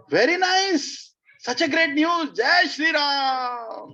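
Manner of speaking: thrilled queen hailing the news; overjoyed. Retold or imitated. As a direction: burst with delight, stretching each word. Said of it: "She was very happy".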